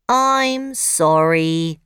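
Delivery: loud robot apology. Acted. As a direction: mechanical robot voice. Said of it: "says Clunk".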